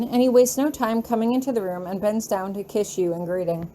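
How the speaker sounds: ordinary delivery, nothing marked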